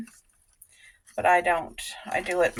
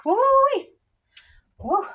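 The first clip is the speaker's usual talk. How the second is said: excited whoop; slower than usual. Real, not acted. Excited cooking exclamation.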